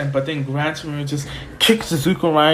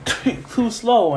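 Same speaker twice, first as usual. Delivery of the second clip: higher, drawn-out teasing; taunt; 8 semitones higher, slower than usual. Retold or imitated. Gran Torino dodging Izuku, taunting. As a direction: stretch it out high to needle him.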